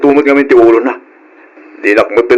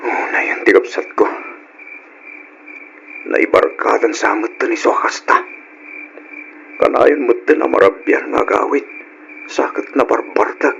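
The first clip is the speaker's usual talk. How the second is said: muttered to himself, barely voiced; whispered grumbling; 6 dB quieter than usual. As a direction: whisper the complaints under your breath. Brother grumbling to himself after she leaves. (agar-arasaas a agngudngod)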